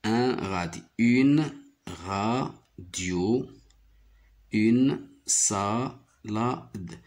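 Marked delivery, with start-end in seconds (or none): 1.0-3.5 slow teacher's split of radio; syllable by syllable. Shown as a demonstration. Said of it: "J'y découpe les mots comme ça". 4.5-7.0 salade cut into sa... la... de; very slow "une salade", every syllable apart. Shown as a demonstration. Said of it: "J'y découpe les mots comme ça".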